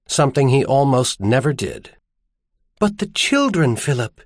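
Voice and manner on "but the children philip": high-pitched plea, falling on the name; pleading. A narrator's reading. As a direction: plead for the children in a raised woman's voice. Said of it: "she said pleadingly".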